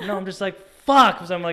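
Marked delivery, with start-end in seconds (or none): 0.8-1.2 loud, high burst of frustration; sudden high-pitched shout; retold scream. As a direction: re-enact a frustrated yell at full volume. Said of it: "I scream in a loud voice".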